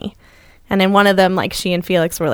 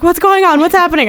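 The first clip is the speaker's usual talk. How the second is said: alarmed niece; 10 semitones higher, 5 dB louder than usual. Retold or imitated. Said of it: "she was like".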